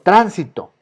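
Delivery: tránsito, TRÁN punched loud; stress on first syllable. Shown as a demonstration. Teacher insists on tránsito, first syllable stressed hard. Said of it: "no es ni transito ni transitó".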